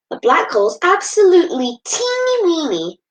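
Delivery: put-on high squeaky teeny-weeny; high tiny voice. A narrator's reading.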